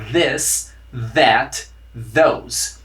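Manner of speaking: this, that, those with voiced th; voiced TH drill. Shown as a demonstration. Teacher leading students through the voiced TH drill. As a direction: buzz the th in each word. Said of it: "Repita comigo".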